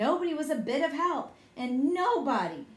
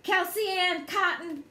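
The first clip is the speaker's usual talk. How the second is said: high full-name call; storybook yell up to someone; 6 semitones higher, 4 dB louder than usual. A narrator's reading. Child calls sister up in the apple tree, yelled. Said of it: "I yelled".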